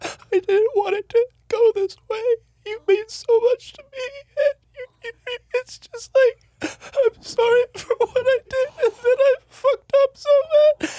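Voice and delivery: very high, wailing mock cry; fake-blubber an apology, pleading; hammed-up sobbing. Losing fighter fake-sobbing to his opponent before the brick.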